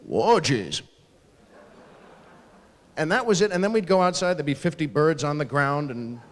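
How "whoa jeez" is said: imitating dad, low startled reaction; low-pitched dad reacting to a blast; father's voice. Father after the coals explode, low startled voice. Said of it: "and then my father's voice going".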